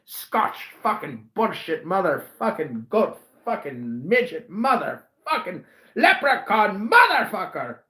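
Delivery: ordinary delivery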